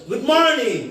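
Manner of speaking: "গুড morning" climbing high on "morning". Shown as a demonstration. Teacher having students repeat a greeting, high "morning". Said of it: "slow থেকে শুরু করে high এর দিকে যাবে".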